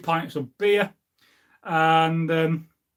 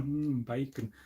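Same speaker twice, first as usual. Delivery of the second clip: soft, dreamy "Mm, bacon", much softer and lower; savouring bacon; 3 semitones lower, 10 dB quieter, slower than usual. Shown as a demonstration. Making viewers hungry, a soft savouring murmur. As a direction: hum it like you can taste it. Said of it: "You've got to say it slowly".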